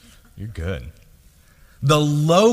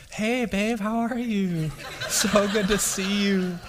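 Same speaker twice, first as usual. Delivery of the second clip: higher, soft sweet phone voice; boyfriend voice; 4 semitones higher, 3 dB quieter than usual. Retold or imitated. Husband answering his wife's phone call in a sweet boyfriend voice. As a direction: coo sweetly to your wife. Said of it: "what's called a boyfriend voice".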